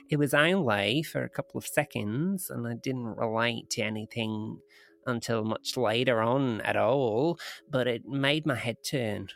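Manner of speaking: lightly put-on Australian accent. Woman recounting a UFO encounter, in a rough Australian accent. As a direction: read it as an Australian witness. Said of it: "doing single-handedly to the Australian accent".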